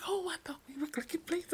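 high whisper, playful mockery; whispered imitation. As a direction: whisper it like the embarrassed friend. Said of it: "She's like".

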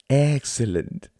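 high, showy stage-host voice; theatrical thin man. Acted. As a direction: play the pompous thin performer lecturing the audience.

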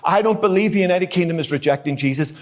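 ordinary delivery

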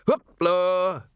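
high, loud showman's cry; shout. A narrator's reading. Old man cueing his animals to pose, high shout. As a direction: call it out like a cue to performing animals. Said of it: "and shouted".

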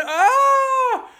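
very high, held comic scream; mock scream. Storyteller plays Rick seeing the mummies again, high mock scream. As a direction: scream in pretend horror, way up high.

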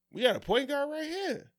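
high-pitched mock indignation; indignant retort. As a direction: act out a cocky, incredulous comeback. Acts out player's indignant retort.

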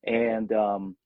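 ordinary talk, nothing marked

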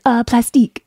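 put-on teasing "plastique" with a French accent; mock the husband's French-accented plastic. Mocking her husband at the store, fake French accent. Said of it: "you would have an accent".

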